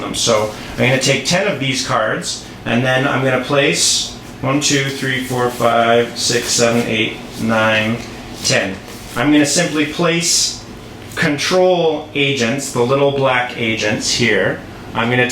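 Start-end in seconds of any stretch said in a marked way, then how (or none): none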